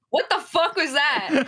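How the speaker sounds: laughing in disbelief, drawn out; startled laugh. Real, not acted. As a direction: react to a weird noise, laughing. Startled by friend's noise.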